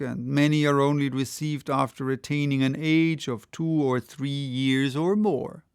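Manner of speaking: ordinary delivery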